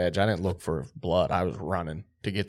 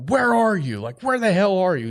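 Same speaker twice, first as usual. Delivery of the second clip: high, loud frantic calling out; frantic, breathless, high-pitched where are you; excited shout; 11 semitones higher, 6 dB louder than usual. Retold or imitated. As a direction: yell while sprinting to the deer. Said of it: "freaking out at this point".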